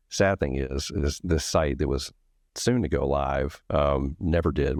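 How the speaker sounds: usual speaking voice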